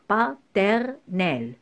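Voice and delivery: "paternel" slowly in three separate syllables; slow, segmented "paternel". Shown as a demonstration. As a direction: say PATERNEL as pa, ter, nel with gaps. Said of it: "couper le mot par syllabe".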